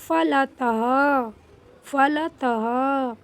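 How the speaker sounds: फलतः stretched very slowly, the final ह breathed out; slow drawn-out reading. Shown as a demonstration. Teacher to children, drawing out फलतः slowly. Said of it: "अब यहाँ पे देखेंगे".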